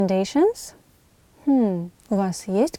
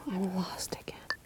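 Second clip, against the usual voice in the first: whisper; 4 semitones lower, 20 dB quieter than usual. Acted. Acted lost traveller at hotel.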